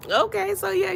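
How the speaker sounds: ordinary talk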